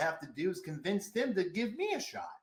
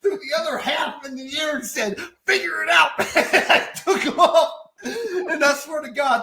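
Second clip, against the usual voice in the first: louder and higher, laughing through it; laughing shout; 9 semitones higher, 8 dB louder than usual. Real, not acted. Guest acting out tossing pages in the air, laughing. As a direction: shout the punchline while cracking up.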